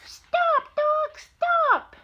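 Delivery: very high, loud bird cry; little bird voice. Acted. Grandma voicing the little green bird, high and loud. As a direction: squawk it like a tiny bird. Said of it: "says the little green bird".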